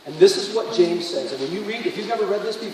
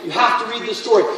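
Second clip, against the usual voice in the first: ordinary talk, nothing marked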